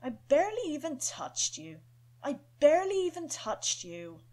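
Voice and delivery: a hint of a firm scolding kid; serious defensive boy voice; stern young boy. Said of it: "He sounds stern and serious".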